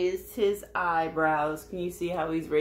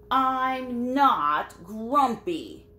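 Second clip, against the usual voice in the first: high, loud, slow indignant chimp denial; insisting; 6 semitones higher, 5 dB louder, much slower than usual. Acted. Reading to kids, Jim the chimp insists he is not grumpy. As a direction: stretch out the protest, loud and high. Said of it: "Jim insisted".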